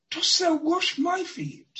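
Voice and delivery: Peter's question pitched high and animated; rising, worked-up disbelief; a worked-up disciple asking Jesus. Acted. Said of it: "he was a little animated".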